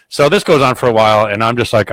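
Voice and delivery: ordinary delivery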